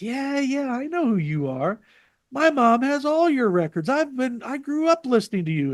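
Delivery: high, sing-song mock of the fan; hammed-up teasing imitation; lightly voiced mock fan gushing. Jay mocking the fan.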